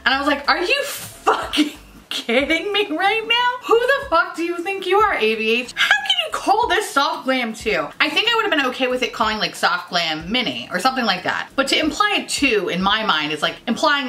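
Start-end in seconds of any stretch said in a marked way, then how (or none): 0.5-7.9 outraged ranting. Acted. Vlogger confronting brand over reused shades, outraged. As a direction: tell off the brand in mock-furious squeals.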